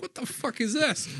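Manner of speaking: high, shocked yelp; shocked outburst. Retold or imitated. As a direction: recoil at the first sip. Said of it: "I'm like".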